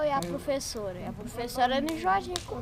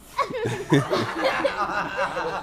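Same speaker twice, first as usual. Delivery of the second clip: loud laugh; 6 semitones higher, 6 dB louder than usual. Real, not acted. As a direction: burst of high, loud laughter. Riso após a pergunta. (gargalhada alta e aguda)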